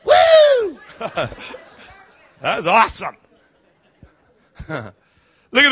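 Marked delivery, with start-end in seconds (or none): 0.1-0.8 high loud celebratory woo; joyful whoop. Real, not acted. Preacher celebrating with the crowd, high joyful whoop. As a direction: let out a wild whoop.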